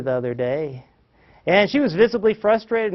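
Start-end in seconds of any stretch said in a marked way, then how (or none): none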